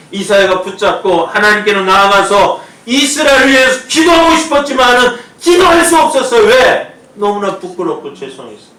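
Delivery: full-force sermon climax; impassioned preaching. Acted. Preaching climax about Isaiah.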